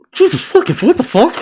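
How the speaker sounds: high-pitched, laughing disbelief; real astonishment. Real, not acted. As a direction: blurt it out, voice shooting up. Shocked laughing reaction to story.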